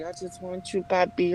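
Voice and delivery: usual speaking voice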